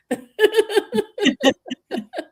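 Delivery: long high laugh rising at the end; high delighted laugh. Real, not acted. Laughing at the on-screen confetti, high and long.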